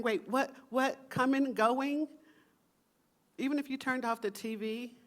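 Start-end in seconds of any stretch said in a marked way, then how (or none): 0.0-2.1 mock confusion. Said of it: "you're wondering".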